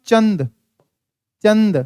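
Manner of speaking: चंद with a clear n before d; careful chand, short vowel plus n; anusvara demo. Shown as a demonstration. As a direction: sound a full nasal consonant in चंद. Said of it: "इसको बोलने के समय".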